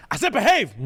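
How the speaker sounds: high, sudden stern outburst; imitating dad's shout. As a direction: snap like a dad at his limit. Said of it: "that level where he has to shout".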